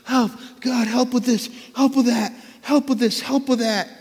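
rapid-fire mock help requests; frantic praying. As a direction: plead like a stressed, tired person. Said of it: "I'm just like".

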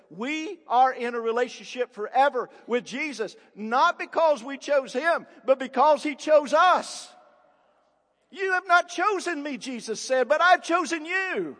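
loud, high, driving pulpit proclamation; preaching at full force. Real, not acted. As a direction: build to a ringing shout, hammering the contrast.

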